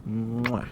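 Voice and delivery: loud smacking kiss sound; blown kiss. Real, not acted. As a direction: blow a big loud kiss to the chat. Blows a kiss to chat.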